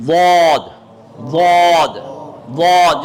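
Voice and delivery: زبان کا کنارہ داڑھ سے لگا کر واد; heavy ض demo. Shown as a demonstration. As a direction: sound واد full and heavy three times. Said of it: "آواز کو بلند کریں گے تو واد آئے گا".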